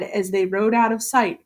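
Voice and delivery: usual speaking voice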